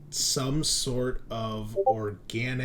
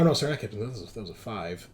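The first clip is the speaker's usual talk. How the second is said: normal talking voice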